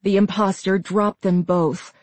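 plain reading voice

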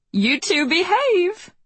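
high, slow teasing mom voice. Acted. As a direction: draw it out with a knowing tease.

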